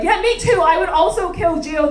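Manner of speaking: hammed-up cheerful reality-TV woman; eager cast member. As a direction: pipe up keenly to agree, a mock reality star. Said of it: "the third woman's like".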